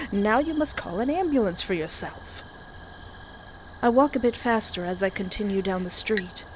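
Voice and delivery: ordinary talk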